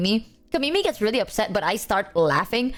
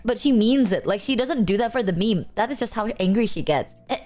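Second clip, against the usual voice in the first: ordinary talk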